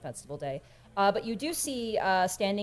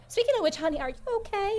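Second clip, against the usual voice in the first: high, cooing check-in; sweet, high character voice; motherly concern; faster than usual. Acted. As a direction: fuss gently over someone. Skinny checks on a guest.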